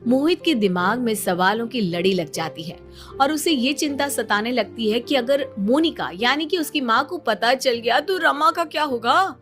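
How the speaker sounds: ordinary delivery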